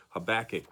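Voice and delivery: Habakkuk stressed on the middle syllable, ha-BAK-uk; stress on second syllable. Shown as a demonstration. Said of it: "with the accent on the second syllable".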